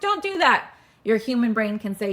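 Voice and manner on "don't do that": high, loud whining protest; whiny animal voice. Acted. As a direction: wail it like a scared animal. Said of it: "the animal body goes".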